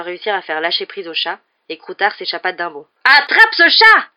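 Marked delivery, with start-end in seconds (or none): 3.0-4.1 full shout, high and drawn out; very loud, high holler; yelling. A narrator's reading. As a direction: yell a slow, urgent order. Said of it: "hurla Ron".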